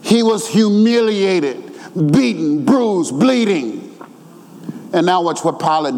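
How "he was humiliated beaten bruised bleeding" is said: high, emphatic sermon delivery; fervent preaching. Real, not acted. Preacher retelling Jesus' scourging to the church, fervent preaching.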